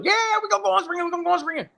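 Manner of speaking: very high, fast, giddy insisting; excited insistence. Retold or imitated. Friend insisting on the phone, high and giddy. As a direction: bounce it out, way up high. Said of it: "He goes".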